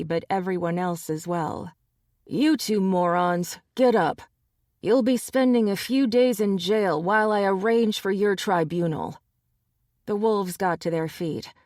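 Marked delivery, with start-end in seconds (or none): 2.3-9.2 louder, raised order; lightly voiced commanding alpha. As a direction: bark them to their feet. Gabe orders the wolves.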